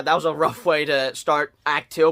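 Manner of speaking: ordinary delivery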